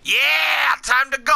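big drawn-out hype yell, high and loud; Otis. Acted. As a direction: shout it like a fired-up wrestler. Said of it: "Not say it like that".

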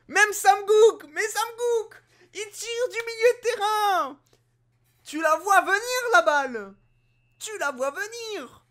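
high, loud outraged disbelief; disbelieving exclamations. Real, not acted. Gamer shouting at a shot from midfield, high and outraged. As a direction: rant in shock at the long shot. Reacts to long shot goal.

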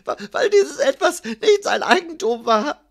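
high-pitched, agitated, whimpering account; tearful, frightened scribe. A narrator's reading. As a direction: stammer into a panicked confession. Sobbing scribe confesses under threat. (weinerlich und ängstlich, mit hoher Stimme)

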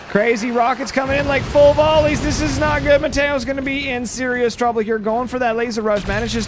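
high-pitched urgent sports call; excited play-by-play. Real, not acted. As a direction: call the barrage in rising alarm. Alarmed live call of rocket volleys.

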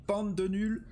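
loud, high furious captain tirade; angry captain yelling. Acted. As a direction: chew out the crew like an enraged captain. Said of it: "qui vient vous incendier, qui dit".

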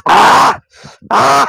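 loud exaggerated ahh after a sip; big hammed-up coffee ahh; loud satisfied ah. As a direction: belt out a pleased gasp after hot coffee. Loud gasp after sipping coffee.

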